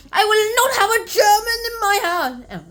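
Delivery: high, loud mock outrage; indignant refusal. Lady Sorton refuses a German lodger, indignant refusal. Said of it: "Lady Sorton is not thrilled".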